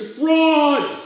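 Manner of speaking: high, loud answering shout; echoing cry. Acted. The echo answers the crowd, loud high cry. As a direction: throw it back like an echo. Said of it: "An echo answered".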